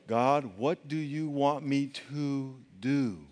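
soft, slow, humble plea; humble prayer. Shown as a demonstration. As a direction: ask it quietly and humbly, very slowly.

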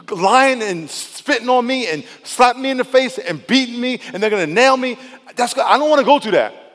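high, loud anguished plea to the Father; acted distressed prayer, pitched well up; Jesus. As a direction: plead urgently, piling up each dreaded hurt. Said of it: "Jesus is going through the same thing".

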